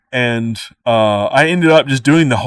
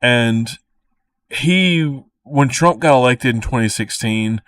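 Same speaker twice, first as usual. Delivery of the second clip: normal talking voice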